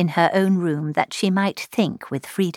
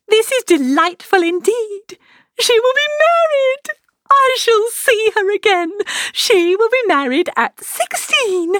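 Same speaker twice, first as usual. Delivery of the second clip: gushing high-pitched, loud rapture; violent delight; 13 semitones higher, 4 dB louder than usual. A narrator's reading. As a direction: exclaim it like an overexcited mother. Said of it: "in an irritation as violent from delight".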